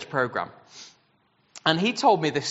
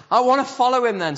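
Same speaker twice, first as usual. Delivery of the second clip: high, loud and fast, bursting with eagerness; eager boy; 10 semitones higher, 7 dB louder, faster than usual. Acted. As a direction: blurt it out like a boy who has just found the answer. Boy decides to follow Jesus.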